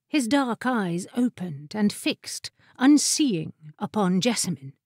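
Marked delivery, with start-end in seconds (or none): none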